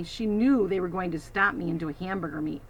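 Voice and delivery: ordinary delivery, nothing marked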